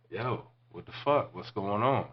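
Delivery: acted confused challenge; confronting. Acted confrontation in retold story.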